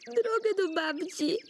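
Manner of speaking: put-on high, whiny lost-girl wail; tearful wailing. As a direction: bawl it out like a lost little girl. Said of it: "No nie rycz".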